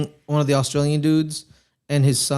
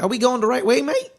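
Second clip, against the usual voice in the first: high mock Australian, climbing sharply on "mate"; horrible Australian accent; 9 semitones higher than usual. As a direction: put on a bad, questioning Australian voice. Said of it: "That's my horrible Australian accent".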